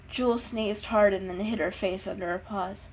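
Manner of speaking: plain reading voice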